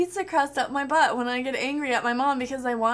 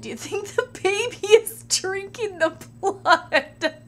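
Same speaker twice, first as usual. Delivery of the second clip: laughing while reading; 5 semitones higher, slower than usual. Real, not acted. Reading question, cracking up.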